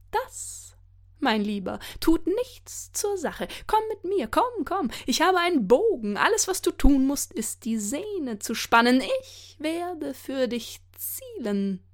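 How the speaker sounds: high, coaxing trickster voice; sly tempter. Acted. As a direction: lure him in like a sly trickster. Loki tempts Höder.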